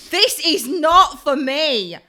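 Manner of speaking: appalled and emphatic; horrified verdict. Retold or imitated. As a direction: draw it out in mock horror, leaning on "not". Retold horrified verdict on sauna.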